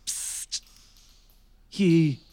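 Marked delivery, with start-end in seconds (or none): 0.1-0.6 soft unvoiced hiss for attention; sneaky whispered psst. Prisoner quietly hailing a cellmate, whispered.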